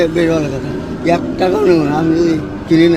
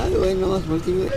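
ordinary talk

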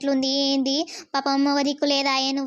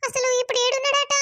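worried daughter; 8 semitones higher than usual. Acted. Daughter asks where father is.

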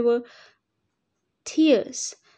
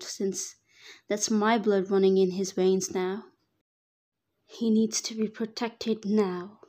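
ordinary delivery, nothing marked

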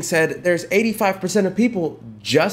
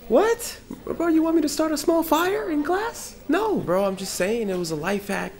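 incredulous classmate; 3 semitones higher, 3 dB quieter than usual. Acted. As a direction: squeak in disbelief at the idea.

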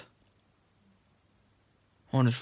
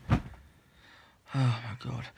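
normal talking voice

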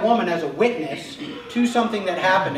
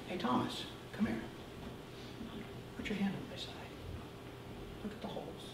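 softer, breathy invitation; tender hush; 3 semitones higher, 18 dB quieter than usual. Acted. Jesus quietly inviting Thomas to touch, hushed. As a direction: gently coax him closer.

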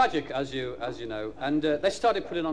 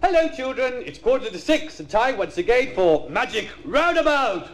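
high, sing-song storyteller for little ones; children's TV presenter; 8 semitones higher, 4 dB louder than usual. Acted. Comedian opening a mock children's show, chirpy presenter voice. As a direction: pitch it up like a kids' programme host.

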